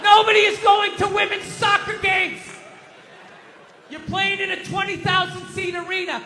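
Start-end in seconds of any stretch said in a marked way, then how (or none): 0.0-2.4 ranting shout. Real, not acted. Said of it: "screaming at the T V".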